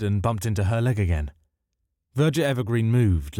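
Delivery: ordinary delivery, nothing marked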